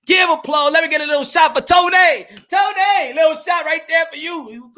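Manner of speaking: high, hollered shout-out to a viewer; excited live-stream holler; hyped shout-out. Real, not acted. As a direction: call for applause at a high pitch. Shouting out a viewer named Tony.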